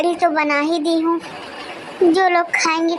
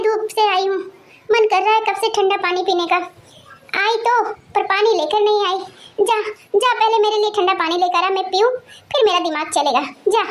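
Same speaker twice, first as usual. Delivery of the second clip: put-on higher, scolding old grandmother; cranky grandma demanding water first; grumbling grandmother; 4 semitones higher than usual. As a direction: grandmother scolding daughter-in-law for not bringing water, higher voice.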